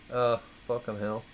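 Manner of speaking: ordinary talk, nothing marked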